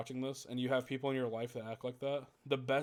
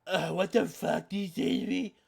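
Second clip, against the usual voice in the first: mock outraged; 5 semitones higher, 4 dB louder than usual. Imitating offended narcissist.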